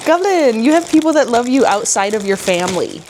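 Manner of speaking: gasp, then gush over the dog; gasping excitement. Real, not acted. Gasps over the dog's gift.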